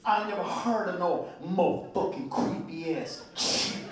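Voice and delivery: lightly voiced Randy. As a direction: drop into Randy's laid-back voice.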